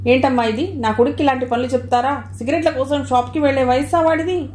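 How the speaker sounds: ordinary delivery, nothing marked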